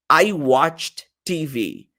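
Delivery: I watched T V said very slowly; slow model sentence. Shown as a demonstration. Teacher slowly modeling I watched TV. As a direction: read the phrase slowly for the class. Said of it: "eu vou falar watched".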